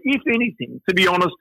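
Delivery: ordinary delivery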